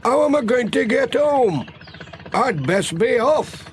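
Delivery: a fretful countryman, pitched high; Alf. Acted. Farmer wondering how to get home, high and fretful.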